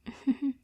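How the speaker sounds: short embarrassed giggle; sheepish laugh. Acted.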